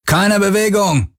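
high, drawn-out shouted order; bellowed command. A narrator's reading. Armed boarder ordering the crew to freeze, bellowed. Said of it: "brüllte einer von ihnen".

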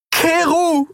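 high, desperate cry of a name; desperate call. A narrator's reading. Endriel begging Kero to stop, desperate cry. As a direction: call him in despair. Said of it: "rief sie verzweifelt".

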